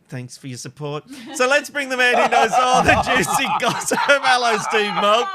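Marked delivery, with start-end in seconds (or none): 1.3-4.0 1 laughing intro. Real, not acted. Introducing gossip guest with laughter. 4.0-5.3 1 loud welcome. Acted. Host calls out guest's name.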